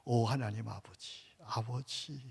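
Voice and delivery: tender prayer. Shown as a demonstration. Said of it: "가르쳐준 기도가 있어요".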